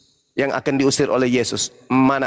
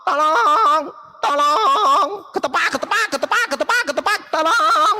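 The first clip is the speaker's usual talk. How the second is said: shrill mock panic, pitched way up; mocking cry for help; 12 semitones higher than usual. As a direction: ham up a tied-up thief screaming for help. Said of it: "kalian hanya bisa teriak".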